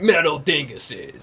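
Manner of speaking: booming monster truck ad voice; monster truck announcer. Pretend. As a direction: hype it like an arena announcer. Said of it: "monster truck announcer".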